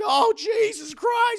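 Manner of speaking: very high, loud, drawn-out panic; panicked exclamation. Retold or imitated. Goofy panicking as the line speeds up. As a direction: yelp it as things go wrong. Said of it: "He's just like".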